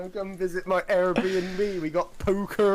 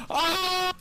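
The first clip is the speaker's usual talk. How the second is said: pained yelp; 14 semitones higher than usual. Real, not acted. Smashed knee into desk.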